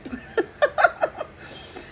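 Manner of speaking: high airy giggle, mimicking the carer; breathy high-pitched laugh; imitated carer's laugh. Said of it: "the carer's like".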